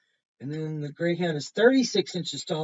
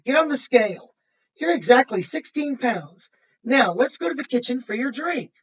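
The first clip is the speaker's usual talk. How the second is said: bright, louder storybook read-aloud; reading to children; 4 semitones higher, 10 dB louder than usual. Acted. Reading a picture book to kids, bright and lively. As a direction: read it out lively for the little ones.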